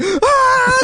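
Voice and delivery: very high-pitched shriek; hammed-up scream of a burning kid; squealing imitation of agony; mock scream.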